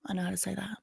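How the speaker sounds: hushed whispered aside. Real, not acted. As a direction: whisper it like a little secret. Proud aside after Spanish phrase.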